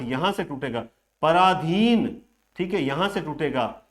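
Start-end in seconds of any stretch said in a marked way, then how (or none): 1.2-2.2 slow पराधीन, leaning on the long रा and धी; पराधीन drawn out with firm stress; stress on long vowels. Shown as a demonstration. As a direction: stretch पराधीन out to show where it joins. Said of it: "वहीं पर ज्यादा जोर दिया जा रहा है".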